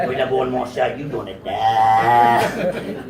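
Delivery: very low, drawn-out Louisiana drawl; hammed-up slow Louisiana prophecy; Louisiana psychic. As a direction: stretch the last word like a Louisiana fortune-teller. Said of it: "she'd be like a Louisiana psychic".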